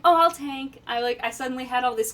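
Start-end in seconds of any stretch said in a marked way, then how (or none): 0.0-0.8 loud, bright volunteering; eager offer. Retold or imitated. To the raid after the tank leaves, loud eager offer. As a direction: call it out, suddenly confident. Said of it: "I'm like".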